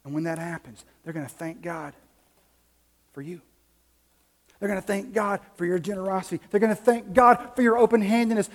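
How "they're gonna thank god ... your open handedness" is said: louder, higher, driving sermon delivery; full-force preaching. Acted. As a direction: preach it out to the congregation.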